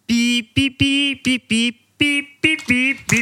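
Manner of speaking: high robotic beeping melody. Pretend. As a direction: beep the tune like a robot. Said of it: "Je suis un robot".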